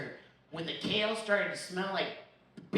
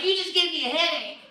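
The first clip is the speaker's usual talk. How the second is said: louder, higher complaint; mock complaint; 7 semitones higher, 6 dB louder than usual. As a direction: whine it loudly. Complaint about loud laugh.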